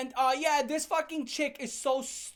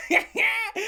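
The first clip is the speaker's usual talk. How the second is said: very high, loud jeering cackle; hammed-up laughing taunt; taunting laughter; 11 semitones higher, 8 dB louder than usual. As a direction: shriek with mocking glee. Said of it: "dancing around him laughing".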